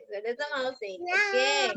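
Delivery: ordinary talk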